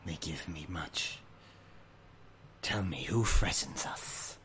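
slow, soft, breathy beast voice; salamander creature. Acted. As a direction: speak low and wary like a caged fire beast. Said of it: "he prowls around his volcano and he says".

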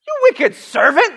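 king roaring at the wicked servant; roared. A narrator's reading. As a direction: roar it down at the servant. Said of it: "the king roared".

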